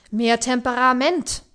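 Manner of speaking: booming, slow director's shout; big resonant thunder voice; thundering call. A narrator's reading. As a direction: roar it across the room to rouse the actress. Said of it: "rief nebenan Werner mit klangvoller Donnerstimme".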